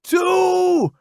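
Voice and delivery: high, loud call; shout. Acted. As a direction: yell the count out. Roddy jumping the count.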